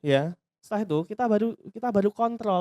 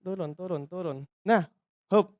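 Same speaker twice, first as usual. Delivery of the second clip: normal talking voice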